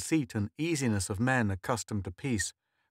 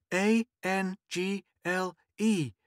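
put-on slow, high mother spelling to a child; 9 semitones higher, slower than usual. A narrator's reading. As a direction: spell it down to her, letter by letter. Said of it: "she spelled down at Anna".

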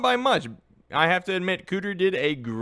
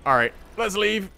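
ordinary talk, nothing marked

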